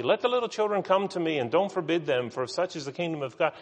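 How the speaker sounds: quick, high, carefree reading; happy-go-lucky. Acted. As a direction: rattle it off breezily, without a care. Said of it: "just kinda happy-go-lucky".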